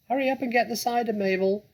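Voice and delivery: high, loud, fast bossy call; calling from upstairs. Acted. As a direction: call down the stairs impatiently. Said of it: "the voice called".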